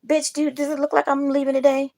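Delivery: high, loud exasperated snap; mock-outraged comeback to a neighbor; indignant retort. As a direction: throw the question back at him, outraged. Retorting to the man at door.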